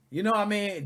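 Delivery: usual speaking voice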